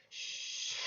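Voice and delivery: long shushing "shh"; imitated hushing. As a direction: hush a screaming crowd. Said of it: "He tells everyone to be quiet".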